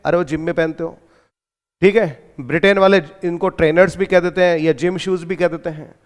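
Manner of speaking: ordinary delivery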